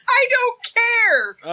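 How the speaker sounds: high, slow mock yell; defiant shout. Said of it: "Casey's like".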